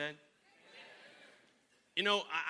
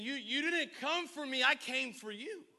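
preaching; 8 semitones higher, 5 dB louder than usual. Acted. Preaching Jesus' words.

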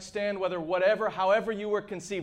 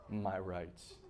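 mocked complaint; 12 semitones lower, 13 dB quieter, much slower than usual. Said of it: "People say".

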